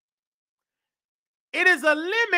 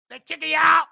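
normal talking voice

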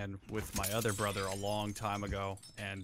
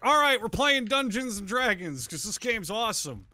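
much higher, louder gamer enthusiasm; mock hyped; 9 semitones higher, 4 dB louder than usual. Big brother excitedly picking Dungeons and Dragons, hyped. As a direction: sell it like it's the best game ever. Announcing the game choice to brother.

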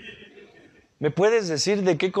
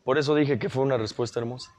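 ordinary delivery

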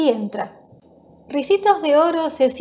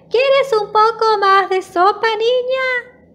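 very high-pitched little bear voice; tiny thin call; 10 semitones higher, 3 dB louder than usual. A narrator's reading. Little bear calls after the girl from afar, thin high voice. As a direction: call out like a small friendly cub. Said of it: "le grita con voz finita".

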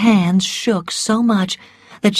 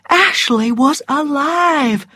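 higher, slow, savoured exclamation; rising elation; 8 semitones higher, slower than usual. A narrator's reading. Narrator voices Scarlett's bursting heart, slow elation. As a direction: let joy swell through every word. Narrated joy, intensified repeat.

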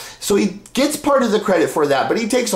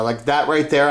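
ordinary talk, nothing marked

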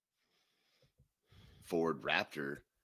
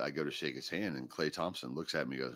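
ordinary delivery

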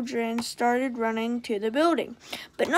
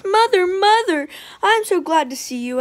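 loud, higher excited call; 5 semitones higher, 6 dB louder than usual. A narrator's reading. Girl running to her mother, excited and louder. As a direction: run to Mom with joy.

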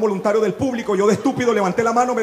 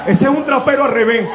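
mock girlfriend voice; 6 semitones higher, 4 dB louder than usual. Woman's excuse voiced mockingly.

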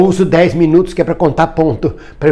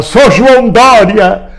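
higher, drawn-out chant; imitated protest shout; 5 semitones higher, 4 dB louder, slower than usual. Man recalling last month's slogan to viewers, mock shouting. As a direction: proclaim the name slowly, rally style. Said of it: "eu gritava".

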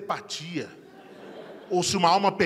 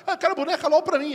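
put-on higher, sweet small-child voice; little girl voice; 10 semitones higher, 3 dB louder than usual. Youngest daughter asking for a doll for herself. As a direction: answer like a greedy little girl.

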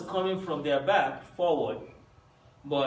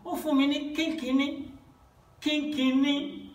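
put-on childish whine; 9 semitones higher than usual. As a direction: whine like a small kid given too little. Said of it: "when we were small, we say".

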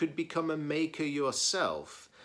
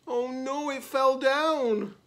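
high, stretched-out acted dismay; dismayed cry; 8 dB louder, slower than usual. A narrator's reading. Reader voices the collapse for children, high dismay. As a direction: wail it as the tower tumbles.

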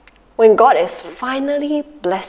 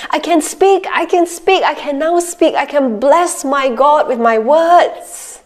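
higher, joyful exclamation; 5 semitones higher than usual. Acted. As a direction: rejoice at finding your voice. Zechariah rejoicing.

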